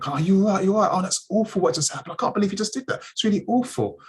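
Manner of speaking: high-pitched, fussing sympathy; concerned women bystanders. Retold or imitated. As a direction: imitate shocked passers-by checking on someone. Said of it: "women came up to me and".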